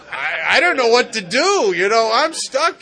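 loud, laughing through the words. Real, not acted. Sponsor laughing about being stuck facing the rage, loud laughter. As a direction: laugh helplessly as you say it. Laughing while recalling the scare.

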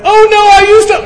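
high, loud comic "oh no"; hammed-up dismay at ketamine; mock alarm. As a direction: yelp the punchline high and loud. Punchline about ketamine.

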